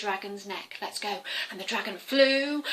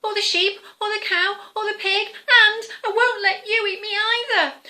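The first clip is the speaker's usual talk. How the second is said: rising higher and louder each line; higher and louder with each item; building excitement; 9 semitones higher, 8 dB louder than usual. Acted. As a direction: build to a breathless high cry. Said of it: "said the Welsh cake".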